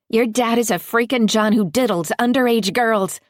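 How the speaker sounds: loud, high scream across the street. A narrator's reading. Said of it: "I screamed".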